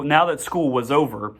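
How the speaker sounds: plain reading voice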